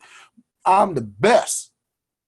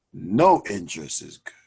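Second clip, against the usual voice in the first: ordinary talk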